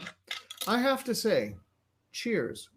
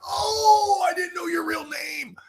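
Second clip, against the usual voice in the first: surprised; 12 semitones higher than usual. Retold or imitated. Retelling his surprised reaction.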